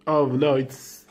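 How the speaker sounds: normal talking voice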